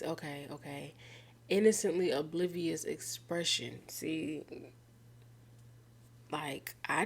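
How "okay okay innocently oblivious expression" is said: usual speaking voice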